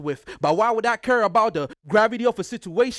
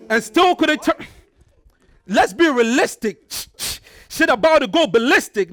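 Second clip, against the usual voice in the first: louder and higher, hyping the crowd; rising battle-rap yell; hyped shouting; 5 semitones higher, 4 dB louder than usual. Acted. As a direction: shout the punchlines at your opponent. Battle rapper hyping his punchline.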